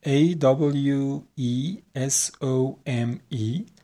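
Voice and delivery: letters dictated very slowly; slow spelling. Shown as a demonstration. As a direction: spell it for the class. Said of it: "spells the word".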